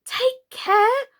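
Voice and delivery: high, loud warning; cry out. Acted. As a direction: call out in alarm. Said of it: "cried Alice".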